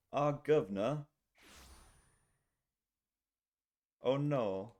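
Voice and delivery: a light, hammed-up British accent; British impression. Streamer doing a joke British accent for chat. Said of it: "You want me to do a British impression?".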